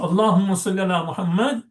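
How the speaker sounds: "Muhammad" stopped on a firm d, tune held up; pause after Muhammad. Shown as a demonstration. Teacher showing where to pause, stopping on "Muhammad". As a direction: recite up to "Muhammad" and halt there. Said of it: "then just pause there".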